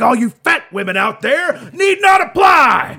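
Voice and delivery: high slow shouted promo voice; wrestling promo. Retold or imitated. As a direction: bellow like a wrestling announcer. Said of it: "He's like".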